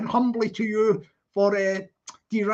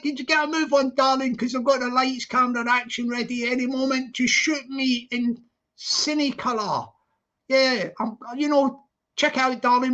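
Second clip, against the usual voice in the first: ordinary talk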